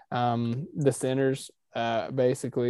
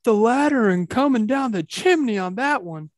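booming high ring announcer call; WWE ring announcer; 10 semitones higher, 6 dB louder than usual. Acted. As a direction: belt it out like a wrestling announcer. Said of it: "I say it like a, uh, like a W W E ring announcer".

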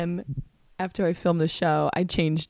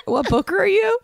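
baffled question; 12 semitones higher, 3 dB louder than usual. Retold or imitated. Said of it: "And I'm like".

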